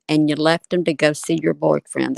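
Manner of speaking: ordinary talk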